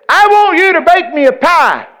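high, loud comic demand; re-enacted shout to someone in the crowd; hollered request. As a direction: call it out across the congregation. Retells his request across hall.

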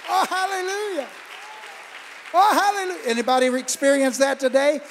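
loud, high, fervent preaching; full-force preaching. Real, not acted. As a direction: whip up the congregation, shouting praise.

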